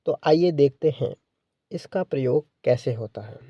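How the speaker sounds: normal talking voice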